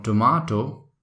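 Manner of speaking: tomato said British, "to-MAH-to"; British tomato with ah vowel and clear T; British accent. Shown as a demonstration. Trainer sets British take against American, British accent. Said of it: "called tomato in British accent".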